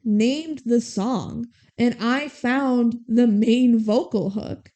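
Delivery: slow, deliberate words, a touch hurt; lightly voiced, slow and pointed; hurt insistence. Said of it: "she goes".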